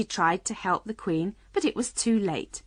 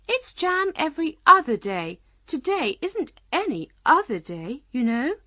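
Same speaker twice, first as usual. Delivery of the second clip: high, louder queenly character voice; 9 semitones higher, 4 dB louder than usual. A narrator's reading. Queen correcting Alice about jam, insistent.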